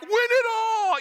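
high, loud sustained cheer; hammed-up sports fan yell; mock fan cheer. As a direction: belt it out like a fan suddenly on board. Mimics fans suddenly cheering team.

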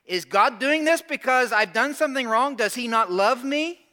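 high, loud pleading question; loud desperate questioning; anguished cry. Pretend. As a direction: cry out to God, hurt and confused. Imagined anguished questions to God.

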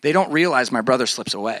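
usual speaking voice

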